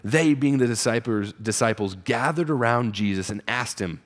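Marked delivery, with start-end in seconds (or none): none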